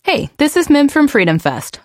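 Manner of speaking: ordinary talk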